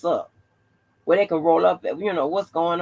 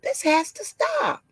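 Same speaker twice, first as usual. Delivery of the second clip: mock-alarmed office lady, pitched way up; 10 semitones higher than usual.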